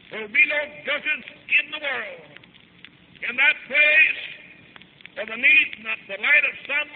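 high, ringing, rhythmic proclamation; full-force preaching. Real, not acted. Preacher proclaims heaven to the congregation, full-force preaching. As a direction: chant out each promise in falling cadences.

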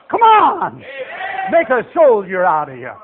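rally the church with a shout; exhorting shout. Real, not acted. Preacher exhorts congregation.

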